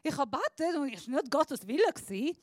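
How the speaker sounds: high relieved puff, then a brisk, fast excuse; mock relief, letting herself off the hook; relieved cop-out. Preacher mocks her own easy excuse, relieved and fast. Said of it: "Weil dann kann ich sagen".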